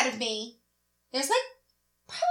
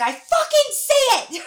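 higher, louder, slow, through laughter; laughing yell; 12 semitones higher, 6 dB louder, slower than usual. Retold or imitated. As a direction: shout back at her while cracking up. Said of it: "And I said".

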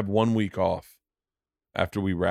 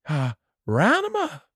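high, drawn-out comic cry; Canadian Mountie impression; 11 semitones higher, slower than usual. Retelling the Mountie interview sketch, high silly drawn-out voice. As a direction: stretch it out way up high. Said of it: "interview with this Canadian Mountie".

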